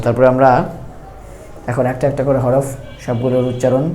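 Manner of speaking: ordinary talk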